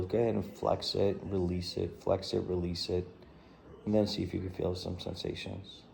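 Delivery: soft, measured meditation voice with long pauses; guided body scan. Real, not acted. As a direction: ease them down through the body, quietly and unhurriedly.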